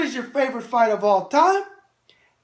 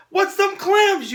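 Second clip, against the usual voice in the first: mock viewer question; 9 semitones higher, 4 dB louder, slower than usual. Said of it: "you say".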